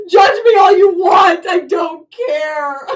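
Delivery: loud, slow, laughing shout of defiance; laughing defiant shout. Real, not acted. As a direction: laugh it out loud, daring them to judge. Defiant laughing outburst at viewers.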